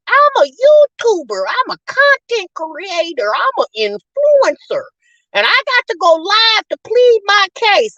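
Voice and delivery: high, drawn-out mock self-important voice; hammed-up high brag, each title stretched out; Jasmine. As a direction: boast slowly as a mocking impression. Mock quote of Jasmine justifying herself.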